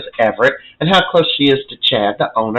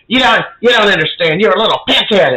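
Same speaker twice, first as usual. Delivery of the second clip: mock angry rant, higher voice; going off on him; 5 semitones higher than usual. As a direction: lay into him in a retold tirade. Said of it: "He is going off on Johnny".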